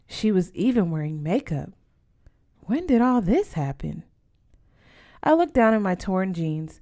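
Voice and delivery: usual speaking voice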